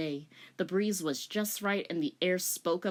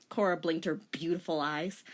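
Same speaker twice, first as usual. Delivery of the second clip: ordinary delivery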